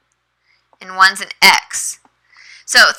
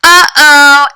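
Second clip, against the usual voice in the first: playful uh-oh; 6 semitones higher, 8 dB louder than usual. Shown as a demonstration. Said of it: "So I would say".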